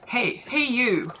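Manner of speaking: high, slow call of hey you; calling out character voice. Acted. Streamer reading game dialogue, a slow high hail. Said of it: "I'm not gonna be doing voices".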